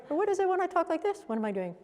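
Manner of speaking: high, quick, lighter voice; high-pitched demo. Shown as a demonstration. Coach contrasting tones for a class, high and quick. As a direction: lift it right up high, then drop off. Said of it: "What's the tone difference?".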